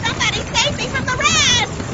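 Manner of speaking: loud, fast panicked shout; desperate shout; cry for help. Acted. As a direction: yell for rescue. Calling for help.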